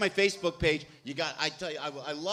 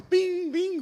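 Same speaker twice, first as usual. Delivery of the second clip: high, ringing car bell imitation; imitated warning chime; 5 dB louder than usual. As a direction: sing out a dashboard chime. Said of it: "I don't see the little bell go off".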